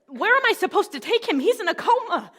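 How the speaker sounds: loud, high-pitched indignant outburst; outraged protest. Retold or imitated. Mother confronting rehab facility over comatose son, outraged protest. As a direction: protest loudly in disbelief.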